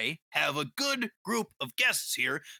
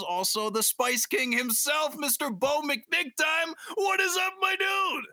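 hype announcer intro; 10 semitones higher, slower than usual. Acted. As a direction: announce him like a boxing champ.

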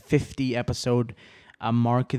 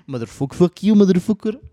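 movie line quote; 7 semitones higher, 8 dB louder than usual. Retold or imitated. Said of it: "I love that fucking scene".

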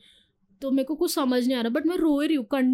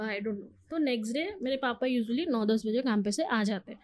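ordinary talk, nothing marked

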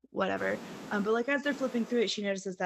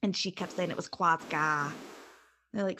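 ordinary talk

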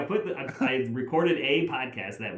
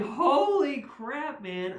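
stunned disbelief; much slower than usual. Retold or imitated. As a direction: squeal it in disbelief. Recognizing a celebrity.

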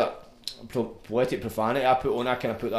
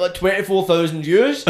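ordinary delivery

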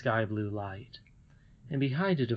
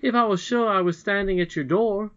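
put-on higher, louder eager boy; eager boy Curdie; 8 semitones higher, 4 dB louder than usual. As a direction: call out like an earnest young miner. Curdie continues answering the lady.

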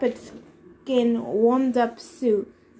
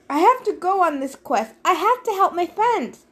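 higher, urgent insistence; insistent plea; 7 semitones higher, 3 dB louder than usual. A narrator's reading. Hero pleads with god to let him go, insistent. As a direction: press it, pitch climbing on the second plea.

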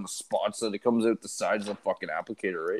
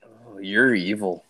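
slow, lower drawn-out teasing accusation; mock sinister; 5 semitones lower, much slower than usual. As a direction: savor each word like a villain. Friend's mock-sinister reply.

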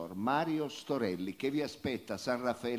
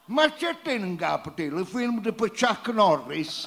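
angry dialect; 6 semitones higher, 5 dB louder than usual. Acted. The dialect rant continues.

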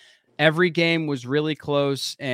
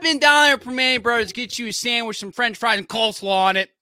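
higher, louder hammed-up yinzer voice; mock Pittsburgh yinzer; 10 semitones higher, 6 dB louder than usual. Hosts riffing on Pittsburgh, mock yinzer accent. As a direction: play a proud Pittsburgh local, hammed up.

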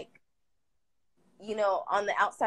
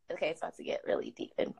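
shaky sigh; 8 dB quieter, faster than usual. Real, not acted. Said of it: "I'm gonna try not to cry".

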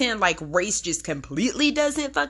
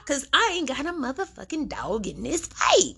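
ordinary talk, nothing marked

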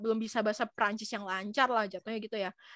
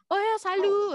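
high, eager hello in imitation; imitated French greeting; 8 semitones higher, 4 dB louder, slower than usual. French man introducing himself to her, imitated eager greeting. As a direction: greet like a friendly French acquaintance.

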